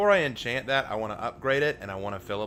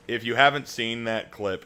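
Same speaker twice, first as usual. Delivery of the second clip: ordinary talk, nothing marked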